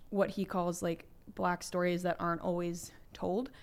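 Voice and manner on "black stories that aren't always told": usual speaking voice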